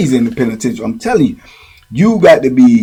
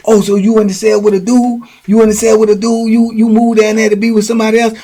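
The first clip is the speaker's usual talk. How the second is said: high, worked-up imitated threats; acted jealous accusation building to threats; jealous outburst; 8 semitones higher, faster than usual. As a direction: rant like a jealous lover, pitched up. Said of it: "he started going into".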